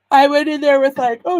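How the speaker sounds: talking through a yawn. Real, not acted. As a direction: yawn while saying it. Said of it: "excuse me for yawning".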